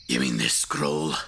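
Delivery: low, slow gruff voice; deep Grok voice. Acted. Player asks the wizard boy about the scroll, low and slow. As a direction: drop way down to play Grok.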